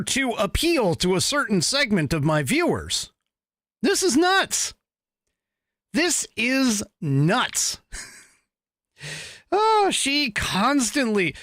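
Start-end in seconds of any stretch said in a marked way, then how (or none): 3.8-4.7 This is nuts, high and bright; high incredulous. Shown as a demonstration. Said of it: "repeats line two ways". 5.9-7.8 This is nuts, drawn out slowly; slow deadpan. Shown as a demonstration. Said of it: "repeats line two ways".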